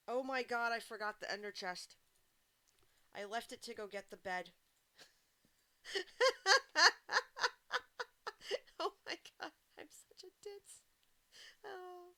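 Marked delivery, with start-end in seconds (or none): 0.1-1.9 louder, startled oh my God; real dismay at a forgotten chest; sudden realisation. Real, not acted. Realises mistake in game. 5.8-8.7 loud, high-pitched squealing laugh; helpless laugh, almost a cry. Real, not acted. Laughing at own mistake. 8.8-12.1 high, laughing oh my God; laugh-crying at her own blunder; laughing self-scold. Real, not acted.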